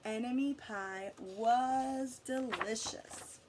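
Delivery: usual speaking voice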